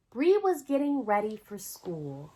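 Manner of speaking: ordinary delivery, nothing marked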